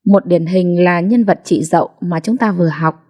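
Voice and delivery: usual speaking voice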